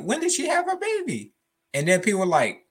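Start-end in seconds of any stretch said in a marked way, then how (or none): 0.0-1.3 mock confused fan. Mocking confused fans.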